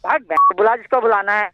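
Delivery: very loud, high angry bellow; man screaming at the caller to bring anyone on; furious shout. Acted. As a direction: bark the dare right at him. Loud quarrel on a prank call.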